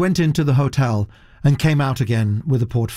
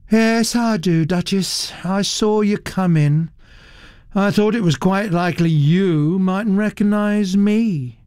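lazy drawl; 8 semitones higher than usual. Acted. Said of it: "She drawled the words lazily".

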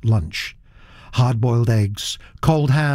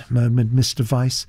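plain reading voice

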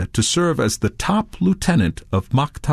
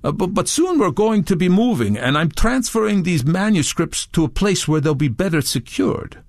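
higher voice, stumbling start; stammering; 7 semitones higher than usual. A narrator's reading. Touré explaining himself to Redbeard, stammering. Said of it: "Touré stammered".

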